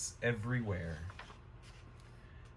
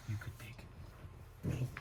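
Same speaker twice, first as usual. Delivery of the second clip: whisper; 4 semitones lower, 12 dB quieter than usual. Real, not acted. Whispering to baby.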